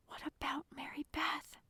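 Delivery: soft, high whispered question; anxious whisper. A narrator's reading. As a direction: ask it small and hushed. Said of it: "I asked in a choked whisper".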